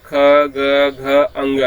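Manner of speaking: ख, ग, घ, अंग spoken slowly and separately from the throat; consonants spelt out. Shown as a demonstration. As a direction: say each throat consonant on its own. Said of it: "क का उच्चारण करेंगे". (कंठ से अलग-अलग ख, ग, घ)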